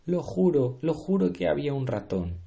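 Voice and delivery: high insistent protest; pleading. Acted. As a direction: swear it, desperate to be believed. Chef protests desperately.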